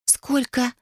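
high-pitched, startled disbelief; недоверчиво. A narrator's reading. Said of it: "недоверчиво покосившись на фамильяра". (удивлённо и недоверчиво, высоким тоном)

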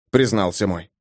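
ordinary delivery

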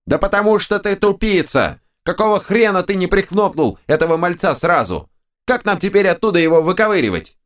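high, squealing scolding; the right head yells at its dim twin; the right head scolds Draken, high and squealing; angry ogre head. A narrator's reading. Right head berates left head.

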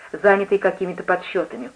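usual speaking voice

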